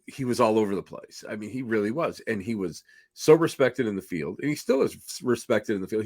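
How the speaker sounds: ordinary talk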